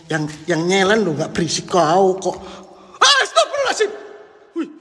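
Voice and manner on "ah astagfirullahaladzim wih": high squeal breaking into a laugh; startled laughing cry. Real, not acted. Streamer spooked in the dark, squealing into a laugh. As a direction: yelp then laugh it off.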